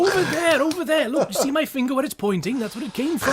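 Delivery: high, loud, mock-helpful; sarcastic pointing.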